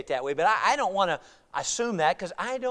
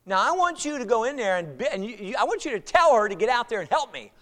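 dominant, pushy; 5 semitones higher, 6 dB louder than usual. Retold or imitated. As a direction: order him to fix it for you. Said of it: "she's pretty dominant".

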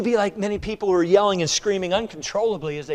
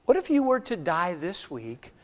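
hushed earnest preaching; 4 semitones lower than usual. Acted. Preacher drops to a hushed plea.

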